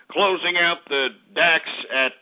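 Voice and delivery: usual speaking voice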